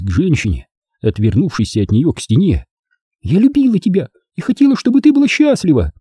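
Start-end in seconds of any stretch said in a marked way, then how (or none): 3.2-5.9 put-on high, quicker childlike lament; complaining. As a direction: plead like a hurt daughter. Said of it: "а жаловалась".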